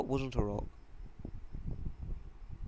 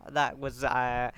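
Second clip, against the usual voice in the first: ordinary delivery, nothing marked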